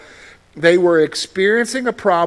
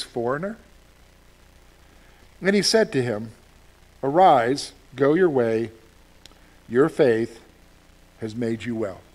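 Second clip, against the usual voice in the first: scripture reading. Acted. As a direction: read the verses with reverence. Reads scripture aloud.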